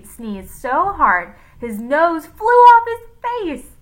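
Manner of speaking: slow, loud and dramatic; dramatic storytelling. A narrator's reading. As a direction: build the climax slowly and loudly.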